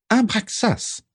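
very high, piping call; high-pitched squawk. A narrator's reading. Prisca accosting Abraxas in his library, high-pitched squawk. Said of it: "piailla-t-elle de sa voix haut perchée".

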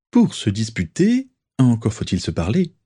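ordinary delivery

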